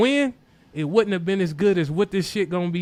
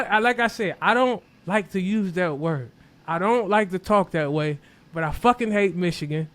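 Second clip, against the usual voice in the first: normal talking voice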